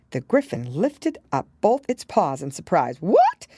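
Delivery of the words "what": much higher, loud shocked exclamation; be flabbergasted she never heard of it. A narrator's reading. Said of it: "it exclaimed".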